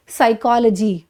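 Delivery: "साइकोलॉजी" as sai-KOL-uh-jee; reduced -logy. Shown as a demonstration. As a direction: finish "साइकोलॉजी" on a short luh-jee. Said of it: "उसी तरीके से".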